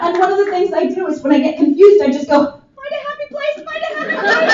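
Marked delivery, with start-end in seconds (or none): 2.8-4.5 high, soft, trembling self-soothing chant; starfish voice. Retold or imitated. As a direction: whimper it like a scared starfish. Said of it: "From Finding Nemo, which is the starfish".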